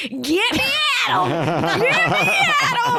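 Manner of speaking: high, very slow, drawn-out greedy cry through laughter; mock eager cry. Woman grabbing at young husband, eager. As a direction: stretch it out, hungry and laughing. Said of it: "she was just like".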